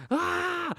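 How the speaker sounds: high yelp of a scream, retold; mock scream. Recalling the first drop, a high imitated scream.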